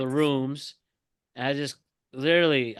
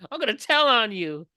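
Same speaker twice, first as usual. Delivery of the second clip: mock crying threat; 8 semitones higher, 3 dB louder than usual. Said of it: "Still crying".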